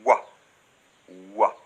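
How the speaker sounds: wa said as w plus open a; oi sound demo. Shown as a demonstration. Teacher demonstrates how O and I sound together, a clear wa. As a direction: shape Wa as a clean w-a glide. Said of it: "se combinent pour former le son".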